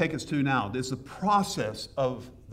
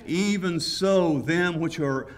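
scripture reading; 4 dB louder, much slower than usual. Acted. Reads scripture slowly.

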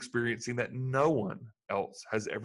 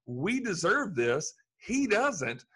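higher, slower rebuke of the other thief; stunned rebuke, pitched up; indignant thief; 8 semitones higher than usual. Retold or imitated. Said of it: "The other guy says".

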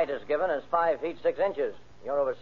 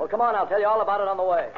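urgent hurry; 5 semitones higher, 6 dB louder, faster than usual. Acted. Hurrying to chase the roadster.

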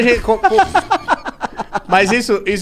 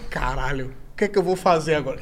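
muttered inner panic; 5 semitones lower, 10 dB quieter than usual. Retold or imitated. Panicked inner thought on stage.

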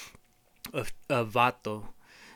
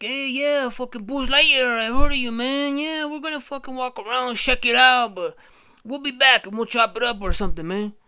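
homies; 11 semitones higher, 8 dB louder than usual. Acted. The homies greeting Buzz, loud and high. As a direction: lay it on as a chill street homie. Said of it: "And they're like".

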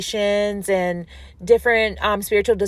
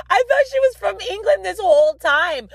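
speaking through a high real laugh; 11 semitones higher than usual. Real, not acted. Confessing her [name] mix-up to listeners, laughing. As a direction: giggle out the confession.

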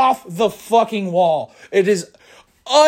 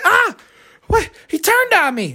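mock shocked woman; 10 semitones higher than usual. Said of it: "You're like".

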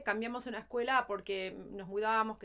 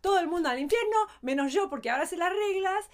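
put-on smug child self; 7 semitones higher, 7 dB louder, faster than usual. Mocking her younger self's certainty.

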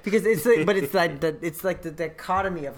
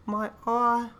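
put-on slow, higher British child accent; little British accent; 8 semitones higher, slower than usual. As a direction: do the son's subtle British accent, a bit babyish. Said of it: "little British accent where it's just like".

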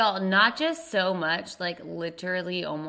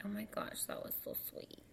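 whispering; 3 semitones higher, 18 dB quieter than usual. Real, not acted. Touched by a sweet review.

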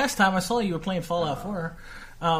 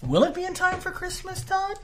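mock high questioning rise; upspeak; 12 semitones higher, 5 dB quieter than usual. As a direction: ask it with an exaggerated rising pitch. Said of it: "it is called upspeak".

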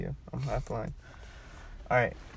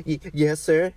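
stammered, slightly raised yes sir; acted flustered obedience; nervous compliance; 5 semitones higher, 7 dB louder, slower than usual. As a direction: stumble into a meek answer, imitating nerves. Acted nervous reply to caller.

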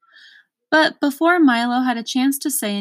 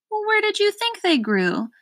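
much higher, irritated snap; irritable shout; 12 semitones higher than usual. A narrator's reading. Earl snapping at Milo, much higher and irritated. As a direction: hurl it at him in irritation. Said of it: "shouted the earl irritably".

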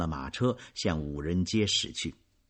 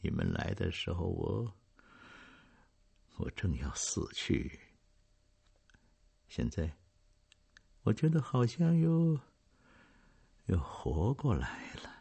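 soft broken murmur; hesitate, catch your breath; faint halting voice; 5 dB quieter than usual. Acted. Dying father to his children, faint and halting. Dying old man speaks.

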